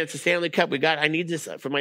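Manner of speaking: high, urgent mock protest; retold scream. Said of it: "I'm screaming at the flight".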